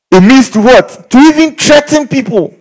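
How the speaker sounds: high, loud call to the crowd; excited call-out. Real, not acted. Preacher calling out the meaning, high and loud. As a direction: fling it out to the church.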